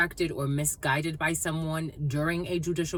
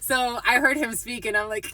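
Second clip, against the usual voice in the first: ordinary talk, nothing marked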